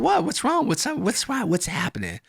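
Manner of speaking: high, feigned clueless voice; mock innocent. Prankster feigning innocence to his angry friend, high and clueless. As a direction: play dumb with wide-eyed innocence. Speaker plays innocent, retold.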